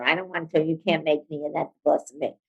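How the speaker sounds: a hint of a sulking brat; bratty teenager. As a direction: mutter it like a defiant teenager. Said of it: "the brat voice, like a teenager".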